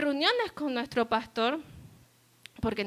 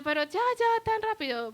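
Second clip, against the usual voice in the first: pitched up high in sudden dismay; dismayed surprise; 8 semitones higher than usual. Retold or imitated. To her friend leaving town, high dismayed surprise. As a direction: caught off guard, pleading it's too soon.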